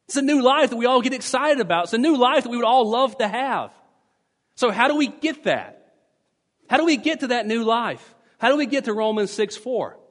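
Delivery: fervent pulpit rise; rousing preaching. Acted. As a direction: lift the congregation with fervent questions. Preacher builds to rousing questions.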